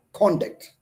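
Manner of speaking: conduct with the weight on the first syllable, CON; stress on CON. Shown as a demonstration. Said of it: "I may stress on the word con".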